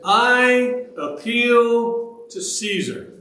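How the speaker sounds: I APPEAL drawn out high, then CAESAR; emphatic declaration. Retold or imitated. Paul answering the governor, emphatically declaring "I appeal to Caesar". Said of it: "are very emphatic here".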